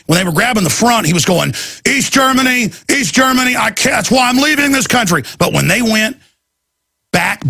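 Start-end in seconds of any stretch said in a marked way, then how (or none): 1.8-5.2 raised, higher-pitched protest, half mocking; imitating Ventura's outrage. Ventura protesting to airport screeners, raised indignant voice. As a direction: blurt it out like a man fed up with being frisked.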